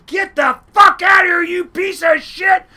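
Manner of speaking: furious high scream, imitated; very loud, high-pitched yell, acting out an enraged woman; screaming lady. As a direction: scream it at full force, like chasing someone off. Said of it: "she starts screaming like hell".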